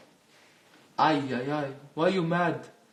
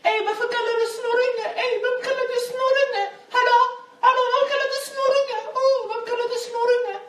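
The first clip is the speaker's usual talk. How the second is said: much higher and louder, mocking the girls' outrage; ridiculing the angry girls at McDonald's, high and loud; 8 dB louder than usual. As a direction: squeal it back at him like an offended teen girl. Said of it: "de blev arga".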